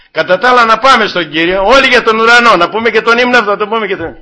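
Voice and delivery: high, loud fervent preaching; preaching at full force. Acted. Preacher urging the flock to the hymn, fervent and loud. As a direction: rouse the congregation to sing.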